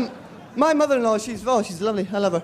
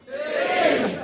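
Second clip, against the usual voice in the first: higher call for a tea; 8 semitones higher, much slower than usual. Real, not acted. Calls for tea on stage.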